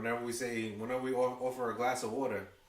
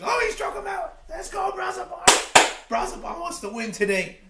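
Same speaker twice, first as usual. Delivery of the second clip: much higher and louder, yelling with joy; ecstatic shout; 13 dB louder than usual. Real, not acted. Streamer celebrating the strikeout on stream, ecstatic shout. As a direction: scream it like your pitcher just escaped the jam.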